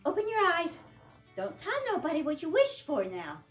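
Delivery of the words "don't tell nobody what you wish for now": usual speaking voice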